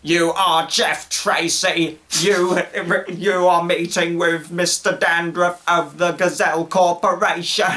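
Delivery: slow, stilted machine announcement; mock robotic lift, drawn out word by word; automated elevator voice. Automated elevator announcing the visitor's meeting, mock robotic voice. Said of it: "and the elevator's like".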